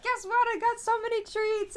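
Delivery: high, bursting talking-dog voice; excited dog voice. Retold or imitated. Dog telling its mom about treats, excited high voice. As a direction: play a thrilled dog, loud and high. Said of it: "the dog gets all excited".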